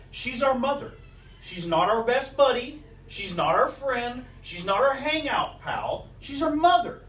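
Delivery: preacher building to a peak; building emphasis. Acted. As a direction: hammer the point, rising to MOTHER.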